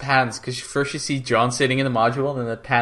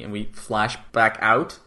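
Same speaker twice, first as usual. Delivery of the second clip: ordinary talk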